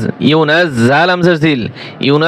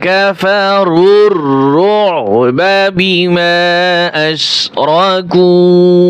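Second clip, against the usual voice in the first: slow melodic tajweed chant, long held vowels; Quran recitation; much slower than usual. Acted. Teacher reciting the verse for students, slow chant. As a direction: recite each word slowly, stretching the vowels.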